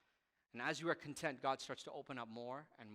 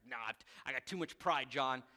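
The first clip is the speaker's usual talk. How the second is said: stubborn mock grudge; 6 dB louder than usual. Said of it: "just be like".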